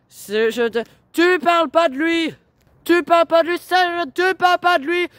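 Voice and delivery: furious yelling. Real, not acted. Daughter screams at her mother not to mention her father, enraged. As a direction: hammer the same line, screaming.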